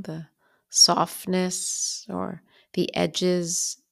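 unhurried meditation guide, long pauses between phrases; calm, even-pitched bedtime guidance with lingering pauses; guided bedtime meditation. Real, not acted. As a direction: ease the listener toward sleep, phrase by phrase.

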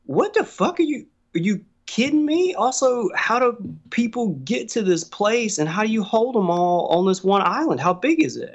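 high, disbelieving rapid questions; mock outrage at what friends said; incredulous retelling. Telling podcast host how he reacted to Australian friends, incredulous high voice.

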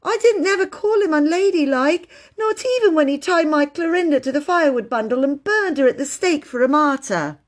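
put-on high, wailing child's protest; sobbing. A narrator's reading. Phyllis complaining to Bobby about Peter, tearful. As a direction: pour out the grievance in a high wail. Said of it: "sobbed Phyllis".

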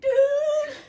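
shocked outcry. Real, not acted. Reacting to throw-up story.

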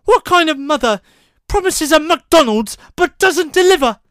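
high, loud exaggerated British complaint; hammed-up posh British boy; mock posh British. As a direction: parody the film line in a silly British accent. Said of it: "a line where he goes".

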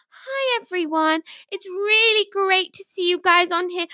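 high-pitched livestream greeting, mimicked; imitated online psychic. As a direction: mimic a gushing online psychic welcoming viewers. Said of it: "She's like".

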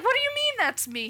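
offended and shocked; shocked at being called that; indignant disbelief. Retold or imitated. Retold indignant reply to fiance.

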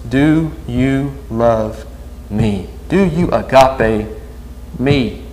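drawn-out tender question, softly; slow, quiet question. Acted. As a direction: ask each word slowly, as if Jesus asks it. Preacher asks Jesus' question slowly.

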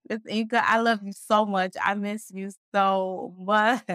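high, heartfelt gushing, slowing at the end; real, effusive fondness; gushing affection. Real, not acted. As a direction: pour out love, stretching the last words. Gushing love to a friend.

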